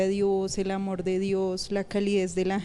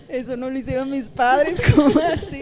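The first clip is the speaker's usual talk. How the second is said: higher, louder, through laughter; laughing disbelief; 4 semitones higher, 6 dB louder than usual. Real, not acted. Habla riendo del choque. (incredulidad entre risas, más agudo)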